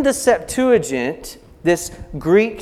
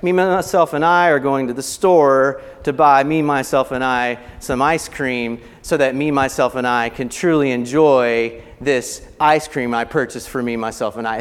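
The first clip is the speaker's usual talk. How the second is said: mock rambling; 7 semitones lower than usual. Shown as a demonstration. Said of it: "too much".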